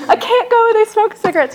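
high, fussy excuse-making; whiny self-mockery. Making excuses to dad about meetings, whiny mock voice. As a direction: whine like you're dodging it, hammed up.

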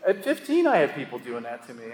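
ordinary delivery

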